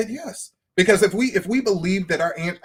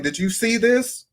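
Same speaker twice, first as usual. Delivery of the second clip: mock nagging; 7 semitones higher than usual. Voicing someone nagging God about prayer.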